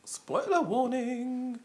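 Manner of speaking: high, drawn-out singsong announcement; mock warning call. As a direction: sing out the warning very slowly. Mock announcement about spoiling film.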